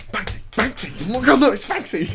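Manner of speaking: high, rising thrilled exclamation; excited Banksy. Retold or imitated. Retelling the friend spotting Banksy excitedly. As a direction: gasp out the name in delight. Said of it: "He goes".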